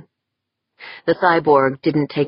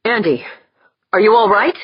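raised, urgent concern; worried call; 7 semitones higher, 5 dB louder, faster than usual. Acted.

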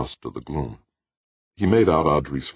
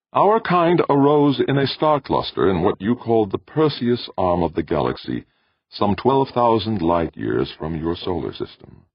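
ancient machine intelligence; 7 semitones higher, 4 dB louder than usual. A narrator's reading. Ancient machine tells visitor its origins, composed tenor. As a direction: recount origins in a composed, raised voice.

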